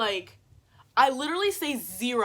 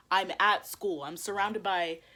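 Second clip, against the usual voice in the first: normal talking voice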